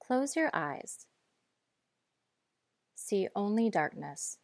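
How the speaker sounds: usual speaking voice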